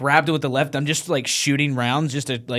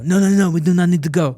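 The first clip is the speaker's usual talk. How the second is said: put-on higher-pitched French accent, protesting; 5 semitones higher, 3 dB louder than usual. French reporter refusing to leave under fire, French accent. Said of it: "I could do an impression".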